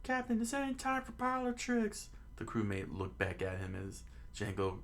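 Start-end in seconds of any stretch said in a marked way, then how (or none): 0.0-2.1 very high falsetto complaint; high put-on pirate voice; pirate crewman. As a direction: object in a squeaky cartoon voice. Pirate's line in the story.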